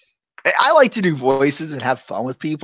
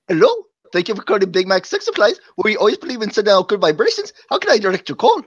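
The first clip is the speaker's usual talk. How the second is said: put-on fast, high call-center greeting in an Indian accent; 6 semitones higher, faster than usual. Prank-answering a telemarketer's call in a put-on Indian accent. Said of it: "I do a decent, you know, Indian accent".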